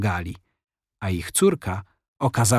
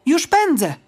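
put-on high, loud eager call of a girl; eager girl's cry; 3 dB louder than usual. A narrator's reading. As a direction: shout it on the run, keen to help. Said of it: "krzyknęła dziewczynka".

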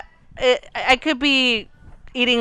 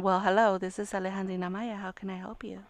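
much softer, lower phone greeting; hushed professional phone answer; 4 semitones lower, 14 dB quieter than usual. Retold or imitated. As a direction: imitate sister's polished phone voice. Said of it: "her professional voice".

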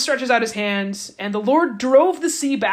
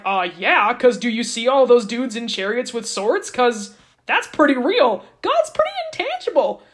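sceptical Israelites; 3 semitones higher, 5 dB louder than usual. Retold or imitated. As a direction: needle Moses with obvious sarcasm. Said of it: "the people were kinda like".

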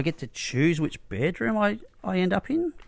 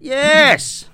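loud, high shout of approval; sudden exclamation shouted into someone's ear; 10 semitones higher, 6 dB louder than usual. Real, not acted. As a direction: burst out with a shouted, high-pitched word. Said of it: "don't shout at my ear".

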